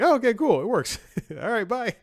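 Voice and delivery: squeaky happy goodbye; chirpy. Retold or imitated. Retold relieved cheerful reply.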